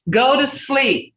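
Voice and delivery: "Go to sleep" as a parent's clear instruction; firm command. Shown as a demonstration. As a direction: order it firmly to bed. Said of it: "these are commands".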